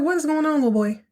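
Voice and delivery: mock aunt scolding her nephew; condescending aunt. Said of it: "She's like".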